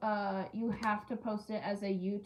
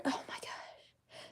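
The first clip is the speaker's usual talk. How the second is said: whisper; 7 dB quieter, slower than usual. Real, not acted. Cut hand still hurting.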